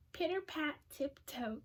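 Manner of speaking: plain reading voice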